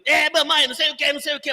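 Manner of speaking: mock the kid chiming in; child voice. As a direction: pastor imitating his little son. Son imitating in parents' quarrel.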